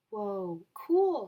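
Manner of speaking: wowed by the photograph; amazed. Acted. Emilio sees the project photograph, amazed and loud.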